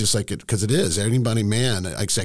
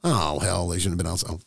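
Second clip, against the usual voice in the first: usual speaking voice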